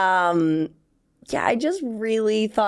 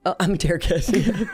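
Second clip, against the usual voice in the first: laughing. Real, not acted. Echoing partner, laughing.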